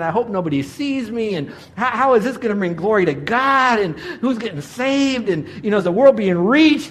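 lightly hammed-up exasperation, voice riding high; exasperated griping. Miserable husband grumbles inwardly over mud, mock exasperated. Retells his exasperated thoughts while panning.

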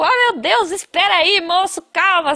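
high-pitched pleading, acted out; flustered retold plea, pitched well up; retold panic. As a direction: plead for him to wait, in mock panic. Panicked learner trapped in seatbelt.

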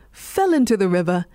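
ordinary delivery, nothing marked

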